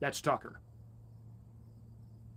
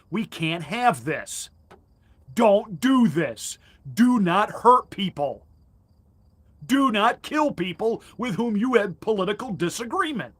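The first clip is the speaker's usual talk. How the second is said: imagined plea; 5 semitones higher, 6 dB louder than usual. Pretend. Imagined Biden plea, slow loud.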